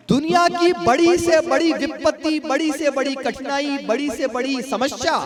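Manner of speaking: each बड़ी से बड़ी stressed, starting high and easing down; oratory build. Acted. Host lists every hardship a mother's blessing beats, rhetorical build. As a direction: hammer each बड़ी from the top.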